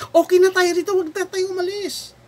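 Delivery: high, insistent mock protest; complacent Israelites. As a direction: voice settlers refusing to budge. Acts out Israelites refusing to leave.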